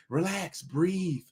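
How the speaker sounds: softly mocking "relax, don't be angry"; mock soothing. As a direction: mimic a patronizing voice telling him to calm down. Mocking the calm-down preachers.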